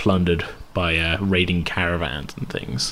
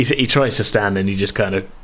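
ordinary delivery

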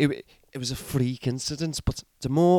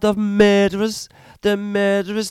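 ranting accusation; 6 semitones higher, 5 dB louder than usual. Acted.

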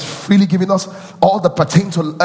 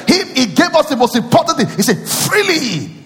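preaching at full force; 11 semitones higher than usual. Acted. Preaching, higher and sung.

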